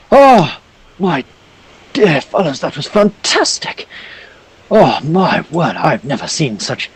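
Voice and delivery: gushing aristocrat, shocked by the beasts; elderly noble in red robe gushing at the heroes, high and theatrical; pompous old gentleman. Acted. Old noble NPC greeting party.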